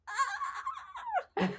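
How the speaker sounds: high excited squeal with a laugh; a sudden gleeful cry; delighted squeal. Real, not acted. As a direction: squeal with pure joy. Showing joy at a smile.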